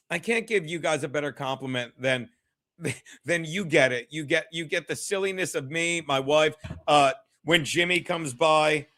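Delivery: ordinary talk, nothing marked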